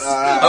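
high strangled "ah", played for laughs; mock choking cry. Audience stringing imaginary nooses in embarrassment, high mock choke. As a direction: act out being hanged, comically. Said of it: "strung an imaginary rope around their neck and went".

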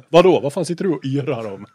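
retold angry challenge; irritated driver retort. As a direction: snap back as the baffled driver. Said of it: "och han bara".

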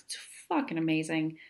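ordinary talk, nothing marked

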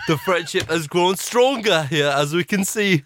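put-on Geordie voiceover; Geordie accent. Narrating the friendship for Big Brother, mock Geordie accent. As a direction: narrate like a Geordie reality show announcer. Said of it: "It's a good Geordie accent".

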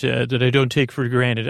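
normal talking voice

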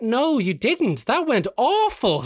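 slow, high-pitched mock dismay; drawn-out, incredulous put-down; blunt contradiction. Friends' blunt verdict, retold.

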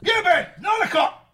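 loud high barking yell; shouting over telly. Acted. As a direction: bark orders at the kids. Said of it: "You were literally barking then".

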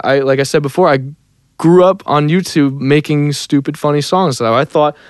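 ordinary delivery

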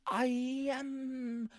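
very high, halting, drawn-out oddball voice; awkward, high-pitched sitcom-neighbour impression; [name] doing Thanos. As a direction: stretch each word high with long gaps. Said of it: "are you [name] doing Thanos".

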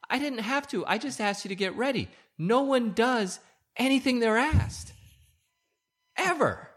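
high, exasperated complaint; frustrated rant. Real, not acted. Husband to wife, frustrated high-pitched exasperation. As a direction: vent the frustration at nobody listening.

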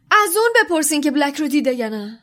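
high, loud, fast plea; urgent insisting. A narrator's reading. Ron urging the professor to ask the portrait, loud. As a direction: press the point hard.

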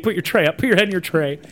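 high, loud mock-attendant commands; bossy order. Fake attendant to staring passengers, bossy order. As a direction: order passengers about in rising panic.

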